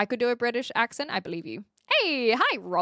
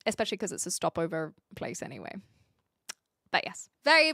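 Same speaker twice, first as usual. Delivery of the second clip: ordinary delivery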